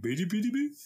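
put-on high baby babble; toddler babble. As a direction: coo it like a small child. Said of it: "be like".

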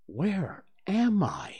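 mock surprise, slow and wondering; drawn-out puzzled question; slow wondering where am I; surprised, slow. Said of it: "She looked properly surprised".

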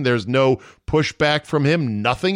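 ordinary delivery

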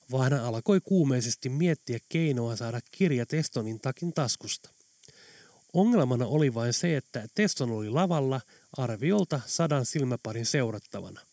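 plain reading voice

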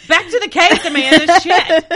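loud, laughing exasperation; loud exasperated. Real, not acted. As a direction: blurt it out, half laughing.

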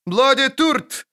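high, drawn-out exclamation; exclaiming. A narrator's reading. Dryad curses as the girl bolts, exclaiming. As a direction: cry out in a high, stretched voice. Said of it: "воскликнула Дриада".

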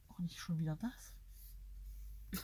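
breathy whisper, slow and soft. Real, not acted. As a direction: whisper it under your breath. Groans at the same song again.

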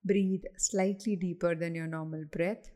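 unhurried, even-voiced relaxation coaching; guided meditation. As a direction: lead listeners gently through breathing, with pauses.

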